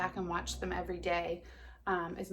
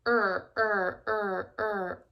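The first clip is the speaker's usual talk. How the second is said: paddling sound; 4 dB louder, much slower than usual. Acted. Rowing sound in the story.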